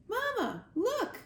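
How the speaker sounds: put-on high-pitched young gosling's cry; little one's excited squeal; excited calling out. A narrator's reading. Gosling calls to mother, lifted by wind.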